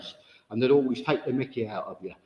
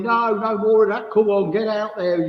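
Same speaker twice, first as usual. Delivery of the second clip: louder, higher mock sergeant urging; hammed-up army pep talk; rousing shout; 6 semitones higher, 5 dB louder than usual. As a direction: push them out the door like a drill instructor. Retold sergeant urging the sitter on.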